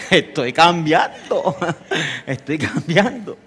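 laughing boast. Acted. Husband boasts he's changing.

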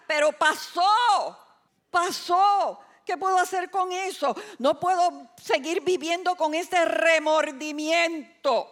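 high, loud, impassioned preaching; impassioned lament. Acted. Preacher voicing someone trapped by remorse, high and impassioned. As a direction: cry it out with acted desperation.

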